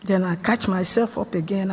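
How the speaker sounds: ordinary talk, nothing marked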